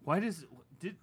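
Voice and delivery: ordinary delivery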